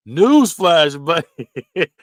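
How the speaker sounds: loud mock sarcastic jab breaking into a laugh; mock newsflash. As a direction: punch newsflash, laugh, then buddy. Mock corny phrase, laughing.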